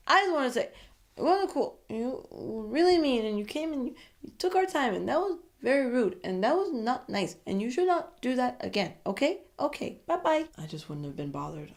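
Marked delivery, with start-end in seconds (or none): 0.1-10.5 higher-pitched, petulant telling-off; mock hurt feelings. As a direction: imitate a wounded guy scolding a rival. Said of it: "all in their feelings and all".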